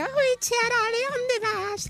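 high squeaky Galician-dubbed cartoon voice, slow. Recalling the Arale cartoon dubbed in Galician, high squeaky imitation. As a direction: mimic the Galician cartoon dub. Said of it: "muy gracioso escuchar a Arale".

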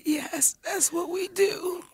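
soft, slow, meek admission; hushed little-girl concession; small chastened reply. Retold or imitated. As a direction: give in quietly, imitating a tearful wife. Retold tearful, chastened reply to husband.

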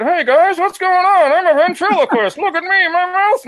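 high-pitched amateur ventriloquist dummy voice; mouth-closed ventriloquist. Shown as a demonstration. Showing a party-trick ventriloquist voice, high and tight-mouthed. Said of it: "talk with their mouth closed".

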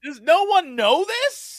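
high, slow, drawn-out disbelief; incredulous. Real, not acted. Host asking co-hosts who miss his reference, incredulous. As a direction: stretch the question out in exasperation.

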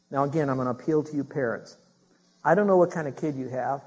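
ordinary delivery

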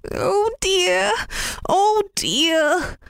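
high, wailing oh dear; dismayed cry. Acted. Rabbit crying out to old man over broken knife, high wail. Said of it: "cried Mister Rabbit".

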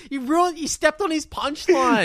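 high, comic outrage; exasperated complaint. Acted. Podcaster scolding the film for spoiling the joke, mock exasperation.

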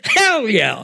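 a laughing Southern "hell yeah"; Southern drawl broken up by a laugh; laughing Southern accent. Retold or imitated. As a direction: quote the Southern friend through laughter. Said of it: "And he said".

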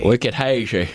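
badly faked Boston accent; terrible Boston accent. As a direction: attempt a Boston accent and miss. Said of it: "I have a terrible Boston accent".

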